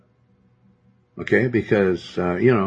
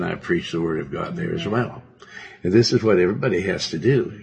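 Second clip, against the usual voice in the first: ordinary talk, nothing marked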